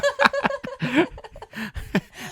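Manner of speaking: squealing burst of laughter; helpless high laugh; high-pitched laugh. Real, not acted. Cracking up at croissant joke.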